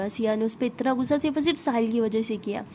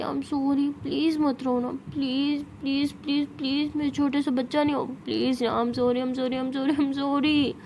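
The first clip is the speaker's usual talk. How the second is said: put-on repeated, childlike wheedling apology; coaxing plea; slower than usual. Siya wiping Tara's tears and apologising, pleading. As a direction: plead on and on to soothe them. Siya soothing crying Tara, begging forgiveness.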